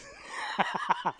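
breathy burst of laughter; laughing. Real, not acted. Host amused by his troll act, laughing. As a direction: laugh at your own silly bit.

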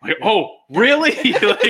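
high, loud, laughing "oh, really"; mock disbelief. Driver retorting to the friend about the wrong way, mock disbelief. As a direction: jump up in pitch for a sarcastic retort. Acted retort in retold argument.

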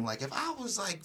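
ordinary talk

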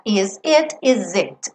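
"Is it" with the s voiced as a z before the vowel. Shown as a demonstration. Teacher models linking to a vowel. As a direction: link "Is it" as iz-it. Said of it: "Esse S ganha um som de Z".

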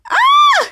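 very high, loud squeal; mock scream. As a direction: shriek in play as a character. Character in retold danger.

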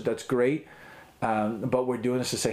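ordinary delivery

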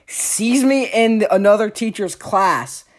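ordinary talk

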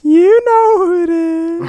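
spooky voice. Acted. Ghost caller voice.

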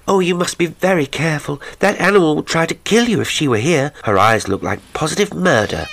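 high-pitched, concerned woman's warning; warning voice, pitched well up; Amelia. A narrator's reading. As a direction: warn him anxiously in a high voice. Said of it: "in a warning voice".

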